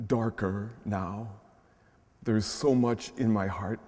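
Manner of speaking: hushed, unhurried reading of a prayer poem. Acted. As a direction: read it gently, letting each line land. Reads a child's grief prayer.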